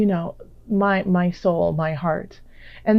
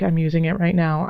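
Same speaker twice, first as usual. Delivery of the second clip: usual speaking voice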